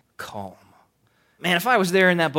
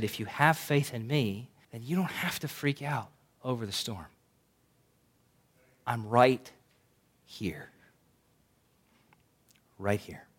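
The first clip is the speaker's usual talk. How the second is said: softer, reassuring imagined Jesus; gentle Jesus; 3 semitones lower, 9 dB quieter than usual. Retold or imitated. Jesus softly reassuring the disciples in the storm. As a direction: comfort them quietly, close by. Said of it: "I'm imagining this is what Jesus could have said".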